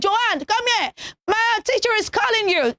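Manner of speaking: high-pitched call to a friend. Acted. Child calling a friend for the teacher, high calling voice. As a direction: raise the pitch to summon a classmate. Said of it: "So if you call your friend".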